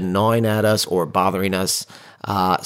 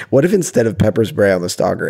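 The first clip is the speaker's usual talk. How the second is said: usual speaking voice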